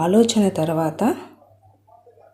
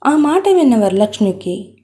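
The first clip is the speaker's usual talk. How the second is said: usual speaking voice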